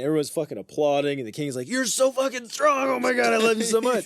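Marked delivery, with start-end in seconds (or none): 1.7-4.1 high, loud mock fanboy gush; gushing king. King applauding the hero who killed the dog, gushing. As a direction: squeal with hammed-up adoration.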